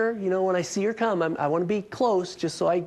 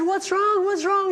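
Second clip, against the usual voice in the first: high, urgent retold what's wrong; alarmed calling out; 3 dB louder than usual. Running outside to the screaming neighbor, high alarmed call. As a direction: call out anxiously to a distressed neighbor.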